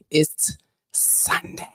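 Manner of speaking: slow, breathy whisper of the same words. Shown as a demonstration. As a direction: hush it like a secret. Repeats Sunday line, then whispers.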